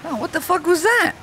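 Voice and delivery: very high-pitched disbelief; shocked outburst. Real, not acted. Gamer hit by something unknown, high shocked outburst. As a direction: squeal it in bewildered protest. Reacts to sudden death in game.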